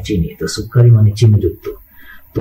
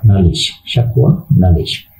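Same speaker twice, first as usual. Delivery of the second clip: usual speaking voice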